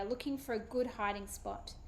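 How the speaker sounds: ordinary delivery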